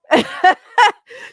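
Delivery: a loud, high laugh that breaks out; burst of laughter. Real, not acted. Streamer laughing at the dumped dog video. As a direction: laugh it off in disbelief.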